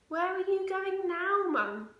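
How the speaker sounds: put-on high, slow, loud questioning kid; a girl calling after mum; slow high-pitched child asking.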